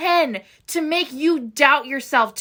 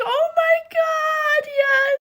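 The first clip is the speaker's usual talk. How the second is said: much higher, slow drawn-out oh my God; excited squeal; 11 semitones higher, much slower than usual. Real, not acted. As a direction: squeal with delight at the reveal. Fangirl reacting to a scene.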